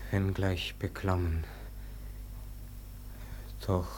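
tief, leise und langsam, zuletzt geflüstert; eingeschüchtert dem Tod antworten; beklommenes Flüstern. Acted. Tor beklommen vor dem Tod. (leises, beklommenes Flüstern)

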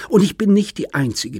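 heated. Acted. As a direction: push it at him hard. Daughter keeps attacking father.

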